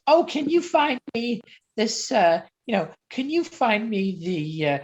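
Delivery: mock customer. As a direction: drag out the questions like a needy customer. Imitating customers asking for items.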